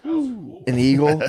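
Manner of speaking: slow, savoured "An eagle"; drawn out. Shown as a demonstration. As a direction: stretch "An eagle" out slowly. Answering with a drawn-out word.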